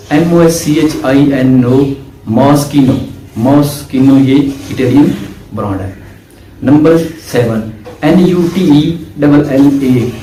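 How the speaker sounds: ordinary talk, nothing marked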